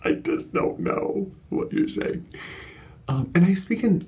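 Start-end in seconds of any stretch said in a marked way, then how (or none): 0.0-2.3 much higher, slow, breathy helpless voice; helpless reply. Retold or imitated. Host answering the French-speaking guest, helpless. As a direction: plead it, lost in translation. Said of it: "and I'm like".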